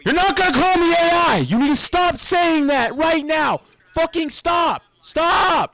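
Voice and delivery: high-pitched indignant yelling; real angry outburst; angry protest. Real, not acted. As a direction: yell at a heckler to stop. Heated quarrel with the caller.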